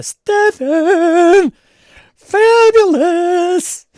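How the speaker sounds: high, loud, drawn-out hail; flamboyant high greeting. Pretend. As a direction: belt the greeting like a showman. Greeting a viewer with flair.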